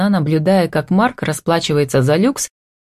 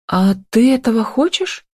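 higher-pitched, startled asking; gasped question; 7 semitones higher than usual. A narrator's reading. Tanya reacting to Mark's talk of conversion, startled. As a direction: ask it higher, taken aback. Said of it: "охнула она".

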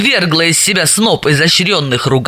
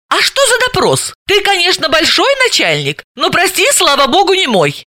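high-pitched indignant retort; 12 semitones higher than usual. A narrator's reading. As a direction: push back, pitch leaping up in protest. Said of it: "возмутилась". (возмущённо, высоким голосом)

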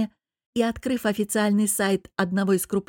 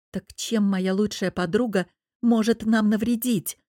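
ordinary delivery, nothing marked

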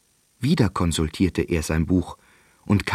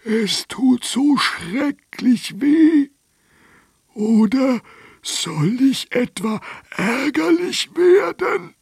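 very high, louder, slow, ending in a shout; threatening dragon; 4 dB louder, slower than usual. A narrator's reading. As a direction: whine turning to menace.

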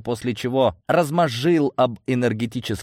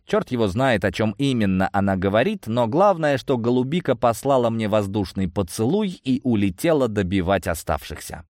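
ordinary delivery, nothing marked

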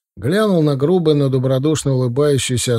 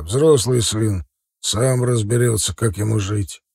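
stern father. A narrator's reading. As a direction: settle it slowly and firmly. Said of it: "сказал отец строго". (неторопливый строгий отец)

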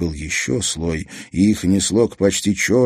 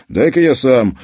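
put-on louder, raised impatient boy; impatient grab; 6 semitones higher, 6 dB louder than usual. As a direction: take over, fed up. Dik grabbing lighter. (нетерпеливо)

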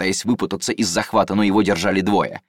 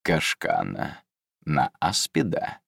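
suspicious; 5 semitones lower, 4 dB quieter, slower than usual. Acted. Suspicious, eyes narrowing.

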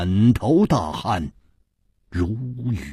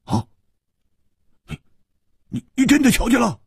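stammer, then high and rushed; gasped hope, tripping over the words; eager astonishment; 13 semitones higher, 3 dB louder, faster than usual. A narrator's reading. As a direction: blurt it out, pitched way up. Desperate man grasps at rescue.

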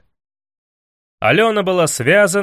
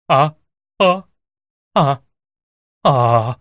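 short yelps; 2 semitones lower, 4 dB louder, slower than usual. A narrator's reading. Алёна being switched by two heroes, short acted cries. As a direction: separate "ah" cries at each blow. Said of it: "промолвила Алёна при первом ударе".

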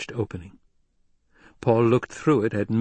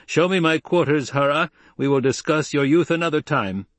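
raised pitch, louder, imposing command; imposing, higher-pitched order; 6 semitones higher, 3 dB louder than usual. A narrator's reading. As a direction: command to instil fear and awe. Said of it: "pitched his voice with tone and tremolo".

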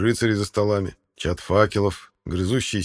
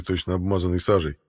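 ordinary delivery, nothing marked

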